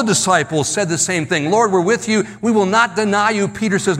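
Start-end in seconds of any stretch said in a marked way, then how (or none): 1.5-3.5 raised, insistent vow of loyalty; emphatic pledge. Retold or imitated. All the disciples pledging loyalty to Jesus, raised and emphatic. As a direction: pledge it eagerly, pitched high.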